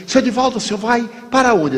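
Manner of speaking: high, fast eager question; curious stranger leaning in. Retold or imitated. (estranho curioso, agudo e rápido)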